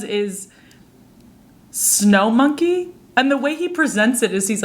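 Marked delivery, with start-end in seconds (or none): none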